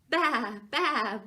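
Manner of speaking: loud bleated baa; bleating sheep. Acted. Parent reading the picture book, sheep bleats loudly. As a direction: bleat it like a lamb. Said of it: "bleated the sheep".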